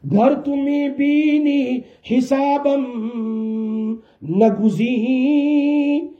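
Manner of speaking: drawn-out, lilting Persian couplet; slow recited verse. Acted. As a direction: chant each phrase slowly, almost sung.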